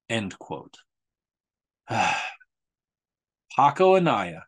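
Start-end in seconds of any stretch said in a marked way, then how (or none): none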